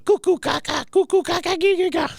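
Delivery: put-on weird baby talk in a very high squeaky pitch; Cotton. Veteran soothing crying baby, imitated weird high baby talk. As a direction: coo absurd nonsense syllables at a baby. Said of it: "just the weirdest baby talk I've ever heard".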